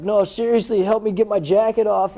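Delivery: high, pleading fake pain, keep up the prank; mock injured plea. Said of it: "I come up all shaking".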